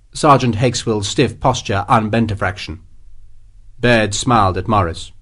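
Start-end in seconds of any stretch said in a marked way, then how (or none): none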